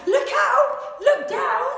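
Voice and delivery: high, loud warning yell. A narrator's reading. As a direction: shout to warn them. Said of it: "who yelled".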